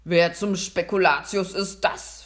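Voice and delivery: loud, baffled Santa demanding an answer; thunderstruck, booming Santa; completely baffled. A narrator's reading. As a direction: burst out with a puzzled question. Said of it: "fragte er völlig verdutzt".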